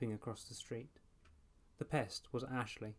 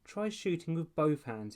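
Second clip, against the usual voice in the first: higher, louder taunt; jeering call; 6 semitones higher, 5 dB louder than usual. A narrator's reading. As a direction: call down a mocking tip. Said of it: "he called down".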